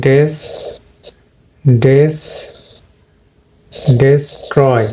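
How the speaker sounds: ডেস্ট্রয় with a short e in the first syllable, DES-troy; common mispronunciation. Shown as a demonstration. Teacher showing learners what people usually say, common mispronunciation. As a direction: say ডেস্ট্রয় slowly in pieces, opening with "des". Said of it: "আমরা কি বলি".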